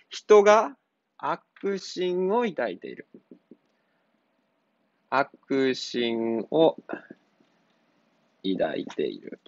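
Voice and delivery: slow dictation. Shown as a demonstration. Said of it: "dictating the answer slowly".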